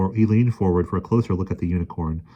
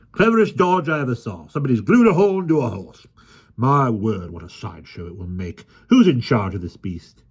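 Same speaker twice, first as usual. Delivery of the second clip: brash showman voice; 5 semitones higher, 5 dB louder than usual. A narrator's reading. Mudge, showman voice.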